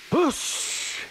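sharp hiss like escaping steam. A narrator's reading. Toby the offended tram engine, hissing. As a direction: hiss it with a long sibilant. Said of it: "hissed Toby".

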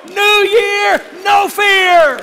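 shouted, high and slow preaching; full-force preaching. Real, not acted. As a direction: preach it out at the top of the voice.

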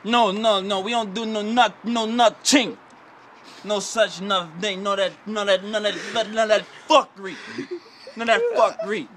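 put-on Jamaican accent. Egged on by friends to be Jamaican, playful scolding Jamaican accent. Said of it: "But I can be Jamaican".

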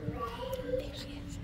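hushed whisper. Acted. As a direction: breathe it out secretly. Whispered aside.

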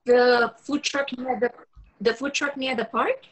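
put-on high, girlish voice for Rachel; young woman's voice. Young woman covering a spill on the phone, high and flustered. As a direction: play the flustered young woman, pitched well up.

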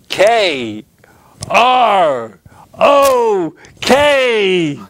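drawn-out high, loud letters; strong, confident voice. Acted. Young Charlie spelling for a loving teacher, strong confident shout. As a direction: belt out each letter slowly and proudly. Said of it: "strong voice".

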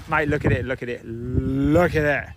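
loud, slow, drawn-out look at it; awed repeated call; excited. Real, not acted. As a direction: marvel out loud at the view. Excited at sunrise view.

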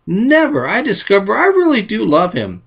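wife who has fallen back in love; emphatic exclaim. Retold or imitated. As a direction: burst out with it, gladly. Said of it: "she exclaimed".

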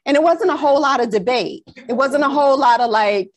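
usual speaking voice

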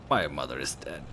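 low, quiet, grave delivery; grim friend. Acted. Friend telling drunk girl of mother's death, low and grave. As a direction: state it heavily and softly.

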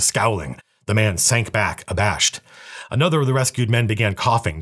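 ordinary delivery

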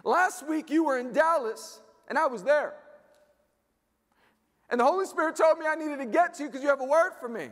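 high-pitched earnest pleading; mock-urgent young lady voice; insistent young woman. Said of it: "She's like".

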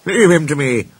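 high, pompous boast; self-important. A narrator's reading. Sir Handel promising to deal with George, self-important. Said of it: "said Sir Handel importantly".

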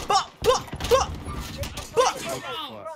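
high, spaced mouth-made shots; mock gunfire. Player emptying the forty-five, high mouthed gunshots. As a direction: pop each shot out high and separate. Imitating gunfire during game.

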